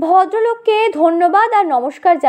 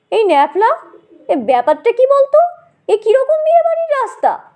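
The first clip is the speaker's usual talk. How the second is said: rising, higher suspicious question; stumbling and doubtful, calling his friend; Nitai; 8 semitones higher than usual. Acted. (সন্দিগ্ধ নিতাইয়ের উঁচু গলার প্রশ্ন)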